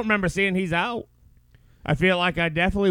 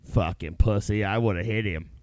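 tough-guy armchair voice; 10 semitones lower, 8 dB quieter than usual. Retold or imitated. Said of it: "it's so easy to sit here and be like".

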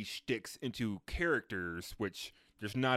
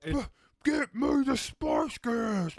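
high, slow, spitting villain cry; evil tabloid boss; 11 semitones higher, 3 dB louder, slower than usual. Retold or imitated. Friend imitating the evil tabloid guy, spluttering high mock rant. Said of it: "the evil tabloid guy, and he's spitting".

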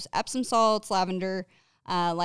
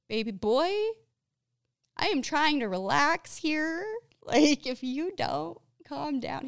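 mock exasperation; 8 semitones higher than usual. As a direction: playfully scold him, voice pitched up. Mock scolding of needy pet.

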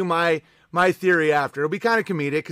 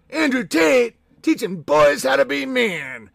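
higher, slow put-on dopey voice; mocking fanboy; 6 semitones higher than usual. Streamer mocking Andrew Tate fans. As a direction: play a dim Tate fan.

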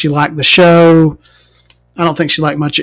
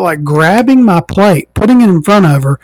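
ordinary talk

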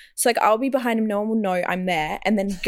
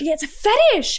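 emphatic agreement; 6 semitones higher, 4 dB louder than usual. Real, not acted.

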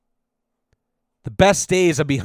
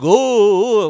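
put-on high, loud little-kid oh; childish oh; 4 semitones higher, 5 dB louder than usual. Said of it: "be like".

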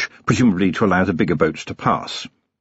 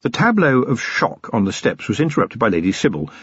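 ordinary delivery, nothing marked

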